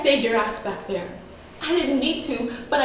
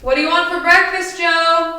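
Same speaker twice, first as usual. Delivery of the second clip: loud, raised mom's call to breakfast; higher, projected parental shout; 6 semitones higher, 8 dB louder than usual. Acted. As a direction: call it out to someone across the house. Mother calls about breakfast.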